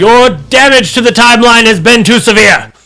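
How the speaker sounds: high, loud robotic yell; loud mechanical voice. Acted. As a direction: shout like a robot enforcer. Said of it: "yells in a very loud mechanical voice".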